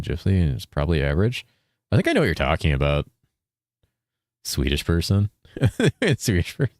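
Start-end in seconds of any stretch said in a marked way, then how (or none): 5.6-6.7 words breaking into laughter; amused, laughing repetition. Real, not acted. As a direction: repeat it through a laugh. Laughing over misheard phrase.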